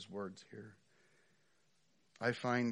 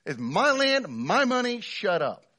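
very loud, high scolding rant; angry landowner; 12 semitones higher, 13 dB louder than usual. Pretend. Landowner scolding ungrateful workers, loud and high. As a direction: chew them out as the offended boss. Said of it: "the response here ... to be like".

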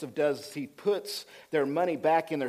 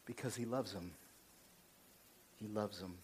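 soft, low and tender; 8 semitones lower, 15 dB quieter than usual. Real, not acted. Preacher explaining why Joseph weeps for his brothers, tender. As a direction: let it sink quietly, with pauses between repeats.

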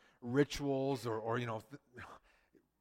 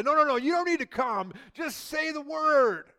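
urgent loud plea; 12 semitones higher, 8 dB louder than usual. Pretend. Emissaries stopping Jesus on the way, loud and urgent. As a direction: call out to stop him on the road.

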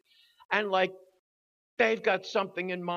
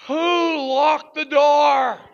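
demanding; 7 semitones higher, 12 dB louder, much slower than usual. Retold or imitated. Confronting the women after escape.

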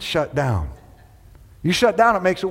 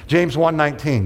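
usual speaking voice

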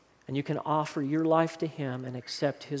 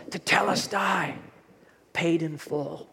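Jesus's final words; 2 semitones higher, slower than usual. Retold or imitated. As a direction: let the words land gently as a last breath. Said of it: "Jesus says".

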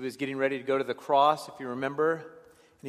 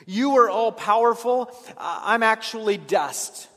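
exalting God; 7 semitones higher, 7 dB louder than usual. Acted. Preacher contrasting God's might.

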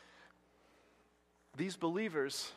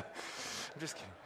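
usual speaking voice

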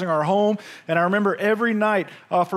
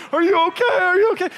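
worried wife; 11 semitones higher, 4 dB louder, faster than usual. Retold or imitated. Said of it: "but she's like".

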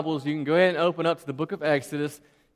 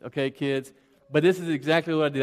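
normal talking voice

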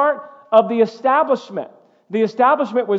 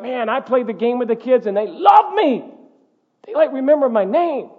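mock bragging; 4 semitones higher than usual. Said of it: "to be like".

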